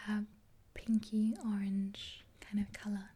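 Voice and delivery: ordinary talk, nothing marked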